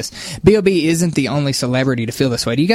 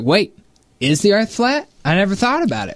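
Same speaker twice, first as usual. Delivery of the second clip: imitating puzzled celebrity; 6 semitones higher than usual. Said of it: "She was like".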